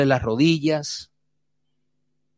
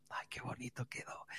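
whispered with a sigh of satisfaction; proud whisper; 2 semitones lower, 30 dB quieter than usual. Retold or imitated. Family answering the visitor's praise, whispered proudly. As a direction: breathe it out, pleased with the work.

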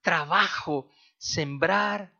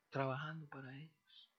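quiet echo; 15 dB quieter than usual. Shown as a demonstration. Preacher repeats to the congregation that they work for the next generation, softly. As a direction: repeat "Trabajando para ellos" much softer, an inward echo.

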